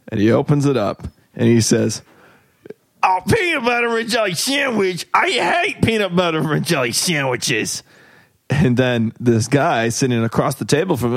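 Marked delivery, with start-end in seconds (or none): 3.0-7.8 put-on whiny grumpy old man. Worker's final complaint.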